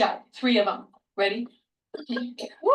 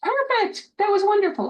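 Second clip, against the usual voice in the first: excited praise; 9 semitones higher, slower than usual. Real, not acted. Therapist cheering the boy's success. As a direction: cheer him on with big praise.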